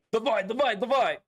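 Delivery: high, loud, hammed-up chant of the Void; dramatic game quote. Quoting Stalker to chat, hammed-up excited cry.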